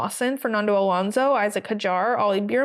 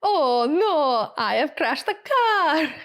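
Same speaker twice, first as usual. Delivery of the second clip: higher, drawn-out mock lament in imitation; Isaac; 5 semitones higher, slower than usual. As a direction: wail it lightly, slow and high, mimicking a driver's radio. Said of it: "favorite radio from Isaac is the".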